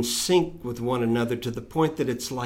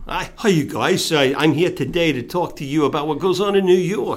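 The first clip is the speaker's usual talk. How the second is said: higher, louder New York accent impression; 5 semitones higher, 6 dB louder than usual. Shown as a demonstration. Guest shows host how people in New York speak, New York accent. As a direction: put on a loud, pitched-up New York voice. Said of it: "How do people in New York speak".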